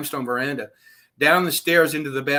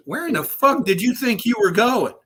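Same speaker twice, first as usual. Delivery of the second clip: high, louder mock-incredulous teasing; amused taunt; 9 semitones higher, 4 dB louder than usual. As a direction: rib him like a grinning boss who caught you running. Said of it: "and he goes, in Spanish".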